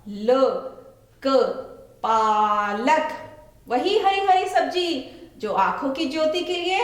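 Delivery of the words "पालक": slow stretched पालक with long पा; drawn-out word. Shown as a demonstration. Teacher to children, slowly stretching पालक. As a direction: model पालक slowly for the class. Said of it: "आ की मात्रा".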